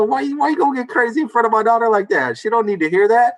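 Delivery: high, indignant telling-off; retold fury. Dad confronting the man who cursed before his daughter, retold furious protest. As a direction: replay your outraged scolding, pitch lifted. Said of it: "I was furious".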